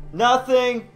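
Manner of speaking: high, loud mock innocent cry; innocent shout. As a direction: call out innocently. Mock answering wife offscreen.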